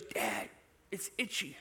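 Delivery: put-on soft, laughing little-girl whine; a hint of a sulky child; small girl's complaint. Daughter tells dad her princess dress itches, soft mock whine. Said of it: "she goes".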